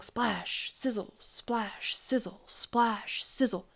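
hushed, drawn-out splash and sizzle; water-sizzle words. A narrator's reading. Narrator voices a leak over the stove, hissing sound words.

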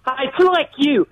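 ordinary delivery

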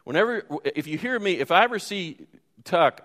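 ordinary talk, nothing marked

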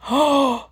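shocked gasp. Acted. Hears swearing at kids.

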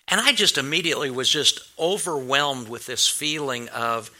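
ordinary talk, nothing marked

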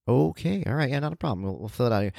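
calm the cranky patient down, softly; placating clerk. Retold or imitated. Clerk placating old man.

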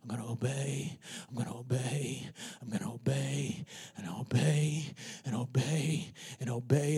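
hushed, barely voiced vow to keep going; soft steady whisper of obedience; whispered resolve. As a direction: breathe it like a private promise. Faithful runner's quiet resolve.

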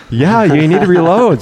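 loud, high, emphatic agreement; mock exasperated confirmation. Hunting partner tells rattled shooter to reload, mock exasperated. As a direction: state the obvious, hammed up.

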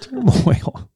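turmoil said high with a New York accent. Shown as a demonstration. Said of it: "That's my New York accent coming out".

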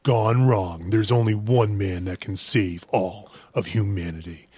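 slow, portentous trailer announcer; movie voiceover. Shown as a demonstration. Host shows off to co-host in movie voiceover voice. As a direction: stretch it out like an epic film trailer. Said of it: "how's my movie voiceover voice?".

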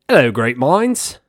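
loud, drawn-out mock Australian greeting; badly faked Australian accent, stretched and loud; shitty Australian accent. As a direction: put on a hammy, terrible Aussie accent. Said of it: "my shitty Australian accent".

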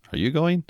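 "going" rising high as a question; question tune. Shown as a demonstration. Teacher models a question: "going" rising high. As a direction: lift "going" high to ask. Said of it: "Or if you're saying".

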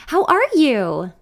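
bright eager question; overly chipper greeting; unusually expressive. Acted. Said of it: "She sounds unusually expressive today".

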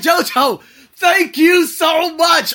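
very slow, high, loud thank-you; overjoyed thanks. Retold or imitated. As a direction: shout gratitude, stretching every word. Acted thanks for the job.

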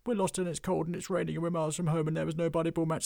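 high, fast rush of frightened excuses; panicked goblin babble. Acted. Goblin pleading with the dwarf, fast and high in panic. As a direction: gabble it all out in one breath.

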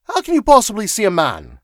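high, loud incredulous cry. A narrator's reading. Wilson challenging the secretary, crying out. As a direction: burst out in disbelief. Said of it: "he cried".

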